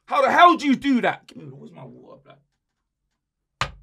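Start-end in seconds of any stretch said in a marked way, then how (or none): none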